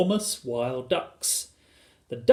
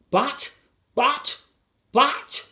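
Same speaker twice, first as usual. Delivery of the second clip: high, stunned outburst, word by word; spluttering cry; 13 semitones higher, 3 dB louder, much slower than usual. A narrator's reading. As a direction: sputter it in high, separate bursts. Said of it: "cried Missus Gregg, going purple in the face".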